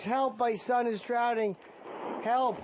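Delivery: high, drawn-out cry for help; slow, high-pitched acted distress call; mock scream for help. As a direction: call out like a frantic mother. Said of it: "she screams".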